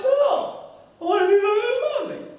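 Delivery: very high, fast mock wail; squealed childish insistence; crying little boy. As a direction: imitate a child's tearful tantrum. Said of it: "the kid starts crying".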